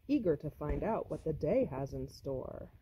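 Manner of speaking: usual speaking voice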